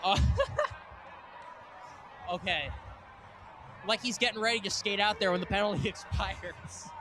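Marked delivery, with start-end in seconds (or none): 0.2-2.3 high, airy giggle; a breathless giggle up high; breathy laugh. Real, not acted. Goalie puts mask back on.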